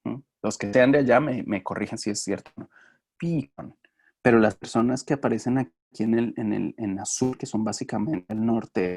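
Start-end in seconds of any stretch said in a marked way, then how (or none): 3.2-3.8 "Pecan" said once more as PEE-kahn, higher; northeastern pecan. Shown as a demonstration. Teacher repeats the Northeast "pecan" for students, PEE-kawn. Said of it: "lo dicen como".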